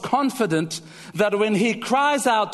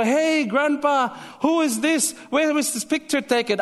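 put-on high, eager child voice asking grandpa; curious grandchild; 7 semitones higher than usual. Grandchild asking grandpa about old photos, high and eager. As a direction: play the curious kid, pitched well up.

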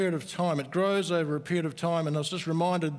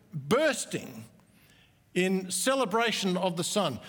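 normal talking voice

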